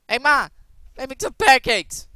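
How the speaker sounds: loud, high call to Ma; calling out to mom. Pretend. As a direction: yell it across the house to your mom.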